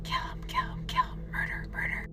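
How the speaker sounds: creepy sleep-talking. Retold or imitated. Roommate talking in her sleep at night, eerie possessed whisper. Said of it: "and she goes".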